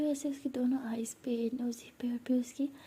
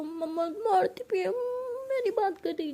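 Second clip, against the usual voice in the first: tearful, higher-pitched complaint about the slap; crying daughter; 8 semitones higher than usual. A narrator's reading. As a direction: whine it out through tears, pitch up. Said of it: "रोते बच्चे room में चली जाती है".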